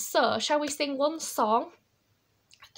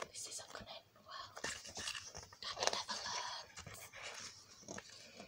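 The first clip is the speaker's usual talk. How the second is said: very soft conspiratorial whisper; whispered aside; 19 dB quieter, faster than usual. Real, not acted. Reader to children about Daddy, hushed whisper. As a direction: whisper it as a secret to the listeners.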